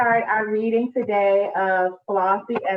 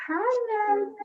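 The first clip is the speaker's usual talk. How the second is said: sing-song greeting; 12 semitones higher than usual. Real, not acted.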